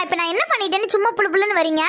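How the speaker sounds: usual speaking voice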